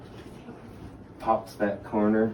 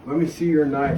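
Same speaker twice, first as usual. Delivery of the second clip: louder, higher voice of a stern dad; father's demand; 6 semitones higher, 6 dB louder than usual. Retold or imitated. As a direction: boom it out like a father asking for the knife. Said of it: "He goes".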